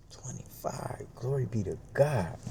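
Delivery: hushed, breathy disbelief; whispered delight. Retold or imitated. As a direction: whisper it to yourself, thrilled. Whispered delight at being carded young.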